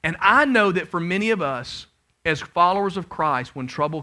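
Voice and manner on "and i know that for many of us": ordinary talk, nothing marked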